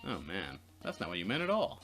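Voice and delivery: ordinary talk, nothing marked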